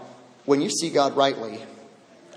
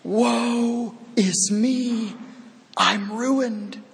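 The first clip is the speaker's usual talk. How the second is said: slow lament; 5 semitones higher, much slower than usual. Retold or imitated. Isaiah's lament repeated.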